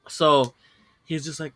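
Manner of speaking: ordinary delivery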